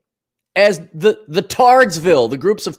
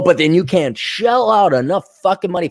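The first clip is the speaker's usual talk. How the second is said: ordinary talk, nothing marked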